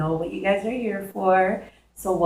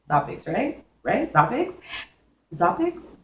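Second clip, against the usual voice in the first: ordinary talk, nothing marked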